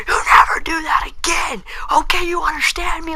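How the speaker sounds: high, strained shrieking principal; mock principal scream. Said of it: "screaming at me".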